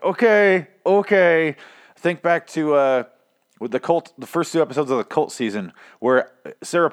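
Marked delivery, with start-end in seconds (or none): 0.0-1.6 high, louder mock exasperation; exasperated okay. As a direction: roll your eyes as you say it. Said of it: "where I'm like".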